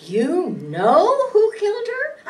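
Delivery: loud, startled disbelief; shocked outburst. Acted. Suspect blurts out in shock at the detective's claim. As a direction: blurt it out, stunned.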